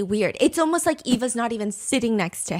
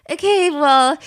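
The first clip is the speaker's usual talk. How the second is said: louder, put-on uneasy brush-off; mock awkwardness; 3 semitones higher, 8 dB louder than usual. She answers the uncomfortable questions, awkwardly. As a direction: imitate someone awkwardly deflecting, voice pushed up in volume. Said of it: "not giggling, but, like".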